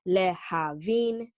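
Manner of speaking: "lehavin" said slowly, syllable by syllable. Shown as a demonstration. Teacher counting syllables in "lehavin" for students, slow. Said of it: "three syllables".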